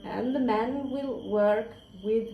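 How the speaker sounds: ordinary delivery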